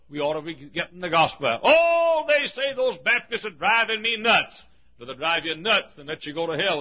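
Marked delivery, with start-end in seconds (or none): 1.6-4.6 high drawn-out "Oh", then complaining; mock exasperation. Townsfolk complain about Baptist soul winners, mock exasperation. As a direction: imitate a worn-out complainer, pitched up.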